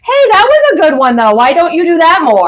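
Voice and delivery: high, hammed-up old man; grudging praise in a cranky voice; grumpy old man. Spirit guide giving backhanded praise, playfully grumpy. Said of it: "that very grumpy old man".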